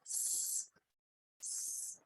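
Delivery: "S-" as a long, soft, voiceless hiss; sustained quiet "s-" demonstrated on its own; Russian S sound. Shown as a demonstration. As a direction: hold a bare "s-" without any vowel. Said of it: "this is Russian".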